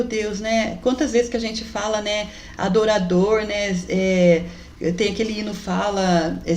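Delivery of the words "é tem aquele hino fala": usual speaking voice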